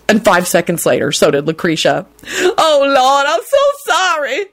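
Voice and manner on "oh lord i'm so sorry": high, slow tearful apology; sobbing. A narrator's reading. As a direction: sob out the apology. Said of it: "she sobbed".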